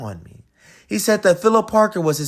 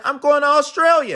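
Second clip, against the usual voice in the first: louder, higher defiant cry; defiant yell; 8 semitones higher, 5 dB louder than usual. Acted. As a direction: shout it back defiantly. Defiant shout.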